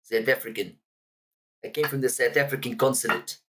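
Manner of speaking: lightly done South African accent; mock South African voice. Shown as a demonstration. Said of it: "I like doing a South African accent".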